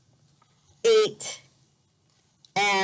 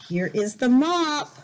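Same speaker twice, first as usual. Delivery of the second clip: ordinary delivery, nothing marked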